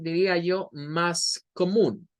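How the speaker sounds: ordinary talk, nothing marked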